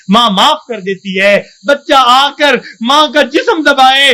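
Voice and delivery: ordinary talk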